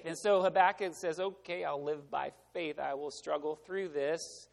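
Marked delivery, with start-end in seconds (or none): 1.2-4.5 lower, softer, reluctant agreement; resigned acceptance. Acted. Habakkuk accepts living by faith, quietly resigned.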